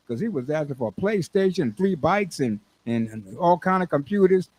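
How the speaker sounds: normal talking voice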